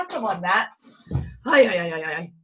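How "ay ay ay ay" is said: ordinary talk, nothing marked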